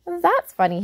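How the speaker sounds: put-on boy voice. Boy Jim voiced in story.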